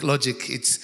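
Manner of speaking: ordinary delivery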